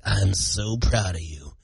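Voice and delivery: low, slow and hushed pride; proud dad aside. Retold or imitated. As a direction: confide it softly, secretly proud. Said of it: "And I'm like".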